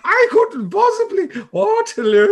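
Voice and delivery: very high, loud, theatrical mock fluster; hammed-up actress impression. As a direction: overact like a terrible actress. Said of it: "This actress is terrible".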